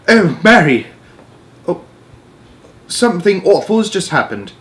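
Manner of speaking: high, regal voice, shaken; a queen just woken, distressed; upset Queen. Acted. Queen tells her maid something awful happened, high upset voice.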